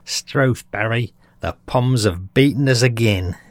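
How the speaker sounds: put-on Aussie sports fan; hammed-up Australian accent. Aussie fan to Barry about losing at cricket, mock Australian accent. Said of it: "or as in rugby football or cricket".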